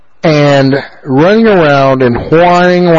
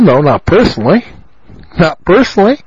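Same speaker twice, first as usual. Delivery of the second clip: ordinary delivery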